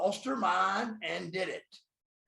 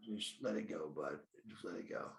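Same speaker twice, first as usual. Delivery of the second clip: hushed tearful plea; 8 semitones lower, 9 dB quieter than usual. Retold or imitated. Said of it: "I said".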